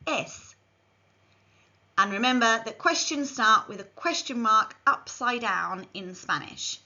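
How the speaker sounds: normal talking voice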